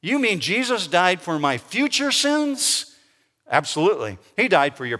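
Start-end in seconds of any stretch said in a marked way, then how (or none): 0.0-3.0 higher, drawn-out question of disbelief; incredulous listener. Retold or imitated. Churchgoer to pastor after hearing about forgiveness, incredulous. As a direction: ask it slowly in mild astonishment. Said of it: "somebody comes up to me and says".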